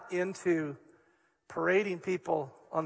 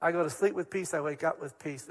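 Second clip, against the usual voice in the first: ordinary delivery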